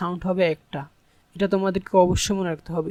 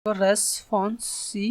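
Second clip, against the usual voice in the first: "Responsibility" slow and softer, six separate beats; syllable by syllable; 5 dB quieter, much slower than usual. Shown as a demonstration. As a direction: break "responsibility" into syllables with a gap after each. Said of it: "ছয়টি syllable আছে".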